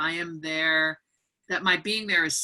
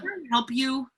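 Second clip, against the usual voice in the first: rude gatekeeping; 7 semitones higher than usual. Retold or imitated. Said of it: "was the tone of voice".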